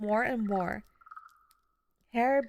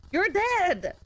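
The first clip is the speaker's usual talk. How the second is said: shouted disbelief; 9 semitones higher, 4 dB louder than usual. Acted. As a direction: yell it out, much higher and louder. Shouting at ghost of husband.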